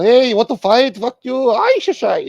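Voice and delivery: mock rowdy Boston drunk picking a fight; drunk Boston Irish guy. Comic riffing on Boston mocks a drunk Irishman spoiling for a fight. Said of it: "Bunch of drunk Irish assholes".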